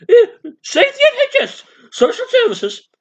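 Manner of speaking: high, loud squawking caricature; hammed-up protester's squeal; mock socialist. Socialist demanding social services, high mock squawk.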